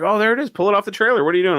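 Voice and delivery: high, mocking voice of eager onlookers. Guy mocking the Vegas crowd urging him off the trailer, high mock voice. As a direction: ham up the pushy crowd, pitched high.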